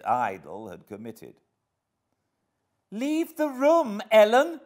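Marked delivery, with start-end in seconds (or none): 2.9-4.6 very high, loud furious order; trembling rage. A narrator's reading. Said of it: "trembling all over".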